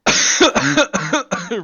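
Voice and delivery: loud, high hacking cough fit; choking cough. Acted. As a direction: cough like you're choking on something. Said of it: "Riley suddenly starts choking".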